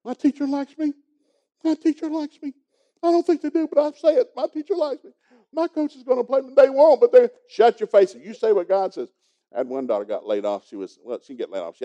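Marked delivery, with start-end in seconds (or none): 0.0-7.3 put-on high, loud child voice yelling it out; kids yelling. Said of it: "I'd make them yell it". 7.5-9.1 louder, higher stern rebuke; father barking at the child; loud scolding. Retold or imitated. Father cuts off the kid.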